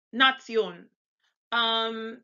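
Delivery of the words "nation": Nation as "nah-TSYOHN", louder; German Z sound. Shown as a demonstration. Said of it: "almost like it's the Z".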